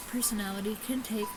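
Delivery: ordinary talk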